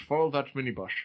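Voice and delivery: put-on light Scottish accent, Sean Connery style; a hint of a Scottish accent. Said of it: "my Scottish accent is getting worse".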